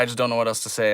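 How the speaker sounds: normal talking voice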